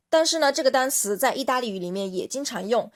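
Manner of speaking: usual speaking voice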